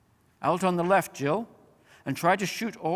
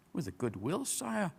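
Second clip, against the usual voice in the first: softer little creature voices; small voices; 3 semitones higher, 7 dB quieter than usual. A narrator's reading. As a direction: answer tiny and eager. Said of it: "came the small voices".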